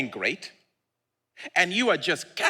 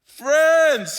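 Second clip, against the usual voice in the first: loud, high Friends; Jesus hailing his friends; loud fond call; 10 semitones higher, 7 dB louder than usual. Acted. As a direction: call it out with affection. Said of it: "he says".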